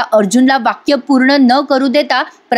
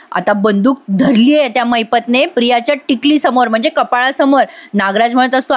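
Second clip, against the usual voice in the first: ordinary delivery, nothing marked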